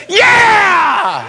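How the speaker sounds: high, whooping yell; far-off cheer. Retold or imitated. Stranger in far back corner of McDonald's, cheering yell. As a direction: holler approval from the back corner.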